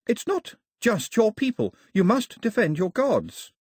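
put-on high, emphatic girl's voice; high-pitched, insistent young heroine; Daphne, urgent. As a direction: press the challenge home as an earnest girl. Narrator voices Daphne urging Mao.

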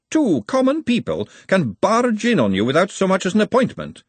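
high-pitched aristocratic indignation; indignant ladyship. A narrator's reading. Ladyship objecting to commoners barging in, indignant. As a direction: raise the pitch in affronted disbelief.

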